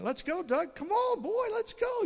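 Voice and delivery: high, urgent self-command; commanding himself. Retold or imitated. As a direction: order yourself out the door. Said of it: "commanding myself".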